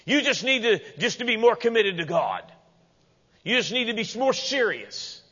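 high-pitched preachy urging; imitating an overzealous adviser; mock exhorter. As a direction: push the advice as a hammed-up nag. Mimics demand for more commitment.

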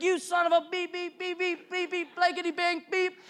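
high-pitched bleeped-out tirade; mock cussing rant. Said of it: "He starts swearing and cussing".

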